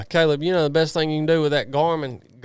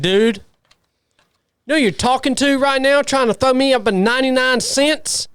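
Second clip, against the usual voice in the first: loud, high, hammed-up indignation; mock fury, rising high and loud; mock outrage; 5 semitones higher, 4 dB louder than usual. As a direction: play up the fake fury at the viewer. Mock outrage at super chat.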